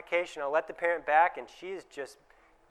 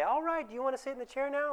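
much higher, coaxing question; what not to say: a pleading, higher question to the child; asking, not telling; 9 semitones higher, 5 dB quieter than usual. Shown as a demonstration. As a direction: sweetly ask permission the child will refuse. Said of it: "Don't say".

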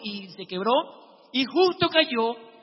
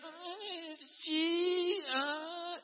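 high, soft groaning reply; hurt old man; 6 semitones higher, 10 dB quieter, much slower than usual.

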